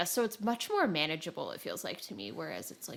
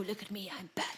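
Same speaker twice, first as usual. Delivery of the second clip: hushed, breathy mock-Batman whisper; playful whisper; 2 semitones higher, 6 dB quieter, faster than usual. Gamer retelling stealth play, whispering in mock pride. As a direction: whisper it like a sneaky superhero. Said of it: "and I'm like".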